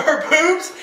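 normal talking voice

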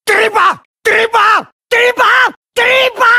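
high yells climbing, last one stretched; building attack shout. Acted. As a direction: yell it with each stab, building up. Repeated attack word, building. (δυνατή κραυγή επίθεσης που ανεβαίνει)

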